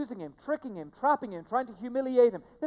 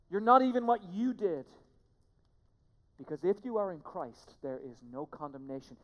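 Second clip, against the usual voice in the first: usual speaking voice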